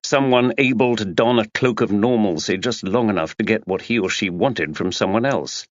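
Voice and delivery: plain reading voice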